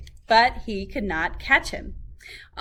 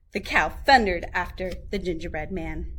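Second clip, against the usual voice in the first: ordinary delivery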